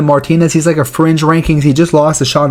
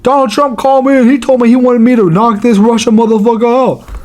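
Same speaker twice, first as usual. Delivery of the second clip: imitating Derek Lewis; 6 semitones higher than usual. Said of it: "he was like".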